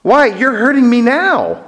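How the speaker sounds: high-pitched hurt complaint; pained protest. Acted. Ghost accusing the angel of hurting him, high pained protest.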